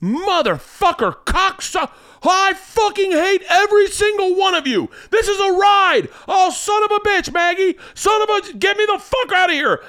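very high, hammed-up panicked rant; comic high-pitched cursing fit; dad screaming curses. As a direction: imitate a dad screaming abuse on a ride. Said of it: "looking at my dad screaming".

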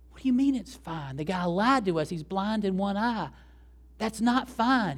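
high, incredulous objection; retold indignation. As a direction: act out a trainer refusing to be calmed. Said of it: "And he's furious".

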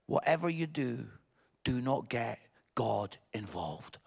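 sigh, then soft, weighty pauses; grave warning. Real, not acted. Preacher warns the room about praying, hushed and grave.

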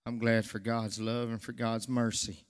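usual speaking voice

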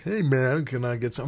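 Bridges impression. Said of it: "it's Jeff Bridges".

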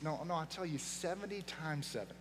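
gentle Jesus. Retold or imitated. Pastor voicing Jesus answering Peter, soft and patient. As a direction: settle him down kindly.

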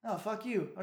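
usual speaking voice

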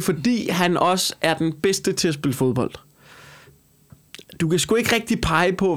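normal talking voice

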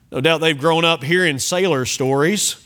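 usual speaking voice

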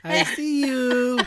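slow, high, laughing creepy taunt. Pretend. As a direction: tease like a lurking stalker. Teasing creepy stalker line.

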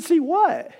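puzzled. Retold or imitated. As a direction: caught off guard. Puzzled reply to neighbor.

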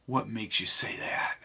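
soft whispered question; whispering. Acted. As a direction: ask it in a whisper. Quiet exchange in the bar.

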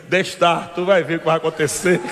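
put-on Jesus's reply in a light northeastern Brazilian accent; lightly voiced northeastern Brazilian accent. Jesus answering demons, lightly northeastern Brazilian accent. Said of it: "na linguagem nordestina".

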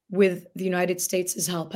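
normal talking voice

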